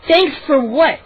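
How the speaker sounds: high, indignant question; demanding. A narrator's reading. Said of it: "she demanded".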